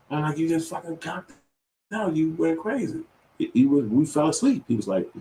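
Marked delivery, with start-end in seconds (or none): 0.4-3.0 alarmed explaining. Retold or imitated. Telling son he fainted.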